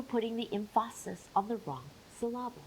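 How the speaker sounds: "emphasis" as em-FAH-sis, "syllable" as suh-LAH-bul; stress on wrong syllables. Shown as a demonstration. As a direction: jokingly shift the stress to the middle of "emphasis" and "syllable".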